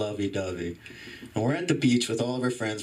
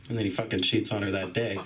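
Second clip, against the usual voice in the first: normal talking voice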